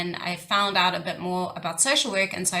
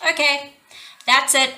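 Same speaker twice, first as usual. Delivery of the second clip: higher and louder, a burst of retold frustration; fed-up outburst; 7 semitones higher, 5 dB louder than usual. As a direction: snap it out like you've had enough. Fed up with abused children's pain.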